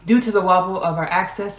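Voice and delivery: usual speaking voice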